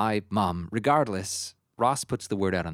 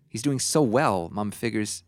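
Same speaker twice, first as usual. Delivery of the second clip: usual speaking voice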